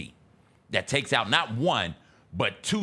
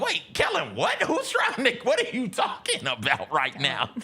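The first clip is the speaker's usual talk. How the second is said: mock bewildered reporter; 8 semitones higher than usual.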